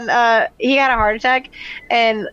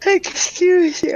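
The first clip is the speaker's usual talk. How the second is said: mock indignant; 9 semitones higher, much slower than usual. Mock scolding after the wine remark.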